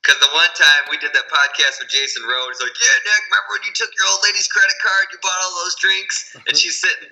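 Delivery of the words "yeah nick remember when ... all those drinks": lightly mimicked friend, higher pitched; higher-voiced retelling of a friend's blurt; imitating a blabbing friend. Imitating a podcast guest recalling drinks within the girlfriend's earshot, higher blurting voice.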